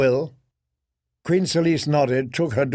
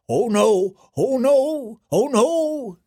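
rising higher each time, jester squeal; panicked fool voice; 9 semitones higher, slower than usual. Acted. Fool Patchface cries out.

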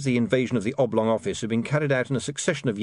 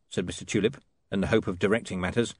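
ordinary delivery

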